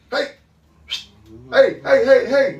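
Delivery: short, high, loud repeated calls; calling out. Retold or imitated. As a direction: fire off quick hollers to halt a running animal. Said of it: "I'm just trying to get him to stop".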